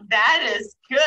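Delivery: loud, emphatic retold delight; delighted approval. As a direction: land it loudly, about to laugh. Retells delighted reaction, breaks into laughter.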